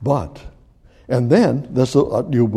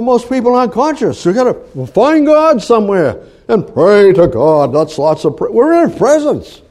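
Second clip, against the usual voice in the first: high, loud, driving preacher voice; fiery preaching; 9 semitones higher, 5 dB louder than usual. Acted. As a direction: preach it hard to the congregation. Preaching at full force.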